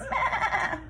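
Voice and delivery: high bleating meh; goat bleat. Acted. Goat answers Duck.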